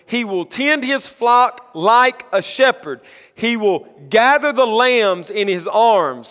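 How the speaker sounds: emphatic, measured proclamation of scripture; scripture reading. Acted. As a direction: proclaim the verse in slow, swelling phrases. Reads Isaiah aloud to congregation.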